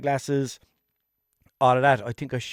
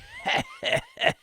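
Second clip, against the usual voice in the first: shrieking cartoon cackle; high mock laugh. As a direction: let out a squealing hoodie laugh. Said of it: "the hoodie was like".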